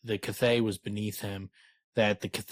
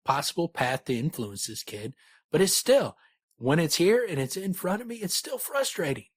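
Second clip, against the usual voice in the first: frustrated Cathay; 7 semitones higher than usual. Acted.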